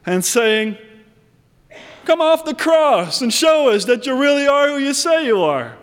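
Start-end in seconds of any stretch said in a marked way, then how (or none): 2.0-5.8 mocking taunt. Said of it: "laughing at him and mocking him".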